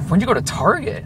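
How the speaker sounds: high-pitched mock surprise; squeaky-high disbelieving question; imitating freak-out. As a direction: imitate an incredulous friend. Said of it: "he's freaking out".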